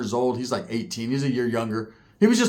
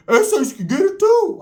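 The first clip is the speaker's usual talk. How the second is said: much higher, louder, slow sing-song taunt; mocking shout; 5 dB louder than usual. Bloods jeering the SA's again, in a high slow shouted taunt. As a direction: stretch out too like a jeering chant. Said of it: "what the Bloods were saying".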